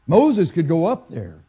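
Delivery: ordinary talk, nothing marked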